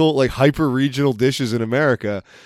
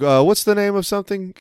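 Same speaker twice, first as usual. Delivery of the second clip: a light put-on Italian accent, pitched higher; 8 semitones higher than usual. Retelling the Italian guy groping for a word, in a light Italian accent. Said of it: "he was kind of talking like this".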